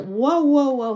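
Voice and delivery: bright delighted wows; excited wow. Real, not acted. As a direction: cheer the new guest with a burst of wows. Excited at a Russian viewer.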